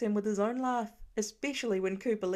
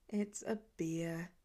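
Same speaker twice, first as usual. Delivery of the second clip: defeated; 4 semitones lower, 6 dB quieter, slower than usual. A narrator's reading. Defeated boy corrects the guess.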